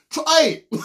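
usual speaking voice

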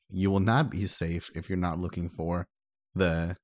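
ordinary talk

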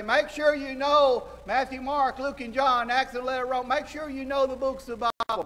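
high, loud insistent parent voice; nagging parent. Retold or imitated. Preacher voicing a parent lecturing children, loud and high. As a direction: drill the kids like a parent. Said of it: "Inconsistency is say".

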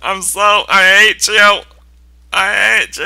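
high, loud wounded lover voice; Minecraft as a jilted lover. Pretend. Game pretends to be an ex left behind for college, whining loudly. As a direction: wail it like a dumped partner. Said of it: "It's like".

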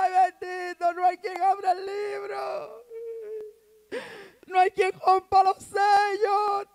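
high, slow, hammed-up wail; mock crying. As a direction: wail tearfully, dragging out the words. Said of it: "Juan estaba llorando". (llanto exagerado, agudo y lento)

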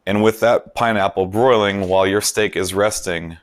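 ordinary delivery

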